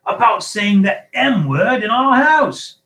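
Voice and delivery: slow, high, furious shout; enraged full-voiced scolding; thundering. A narrator's reading. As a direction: thunder every word at him. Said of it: "thundered his uncle".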